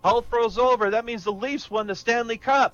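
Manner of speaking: high, loud cheering shout. Acted. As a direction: cheer it out like the Leafs just won. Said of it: "the Canadians shout at the same time".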